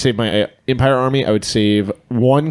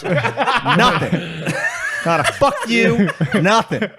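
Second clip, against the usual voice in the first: high, laughing complaint; 8 semitones higher, much slower than usual. Real, not acted. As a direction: laugh through the words.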